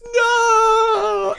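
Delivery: a big high shout of no; retold anguished shout. Wade crying out over his dying dad, loud high shout. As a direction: throw a high, loud cry of no. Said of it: "and Wade goes".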